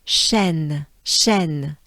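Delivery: "shen" with a soft, light sh and open eh; "shen" breathed gently on the sh. Shown as a demonstration. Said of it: "and a soft sh".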